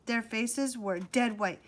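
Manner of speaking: usual speaking voice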